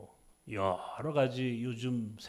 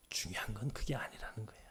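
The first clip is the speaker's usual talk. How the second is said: much softer, lower whisper; hushed confiding whisper; 5 semitones lower, 9 dB quieter than usual. Acted. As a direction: whisper the key point. Whispered key point.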